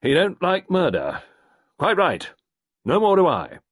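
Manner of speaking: high, loud, fast clipped colonel; Carbery demanding action, high loud colonel; gruff colonel. A narrator's reading. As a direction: bark it like an impatient old soldier. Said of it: "Colonel Carbery said unemotionally".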